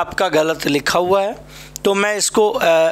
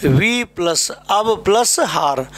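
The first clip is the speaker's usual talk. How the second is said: slow breakdown; slower than usual. Shown as a demonstration. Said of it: "संधि विच्छेद".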